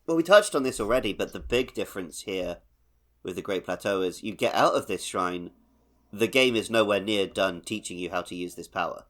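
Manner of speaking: usual speaking voice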